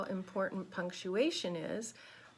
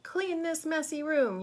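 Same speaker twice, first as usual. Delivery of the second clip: higher-pitched storybook scolding; read-aloud mock yell; mother's mild yell; 8 semitones higher, 6 dB louder than usual. A narrator's reading. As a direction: call out like a mother ordering a cleanup. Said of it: "yelled Mother".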